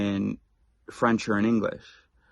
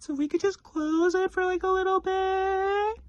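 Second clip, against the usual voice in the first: very high, breathy, hesitant falsetto with long pauses; exaggerated polite coworker; slower than usual. Shown as a demonstration. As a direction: squeak it out as an over-apologetic colleague. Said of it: "There was the timing... eyes closed".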